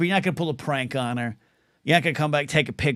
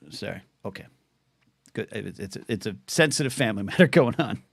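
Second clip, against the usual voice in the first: usual speaking voice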